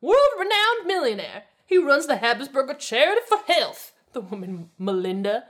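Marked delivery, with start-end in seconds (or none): 0.0-3.9 high, loud, haughty outburst; shrill-edged, loud bragging snob; near shriek. A narrator's reading. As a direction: boast indignantly at the top of your range. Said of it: "nearly shrieked".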